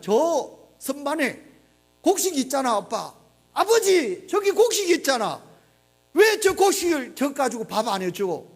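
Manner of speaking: put-on high, pleading child's voice begging father for food; crying hungry child. Hungry child begging father for the grain, high and pleading. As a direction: voice the starving child pointing at the seed grain. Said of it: "자식들이 울면서 뭐라고 손짓을 하느냐 하면".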